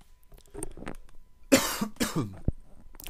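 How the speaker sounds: a rough cough between lines; real cough. Real, not acted. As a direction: cough hard for a few seconds. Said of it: "sorry about the coughing".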